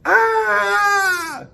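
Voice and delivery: sudden high frightened yelp; a startled scream of fright; scared cry. Acted. Daddy frightened by the angry man, sudden high yelp. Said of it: "Daddy was scared".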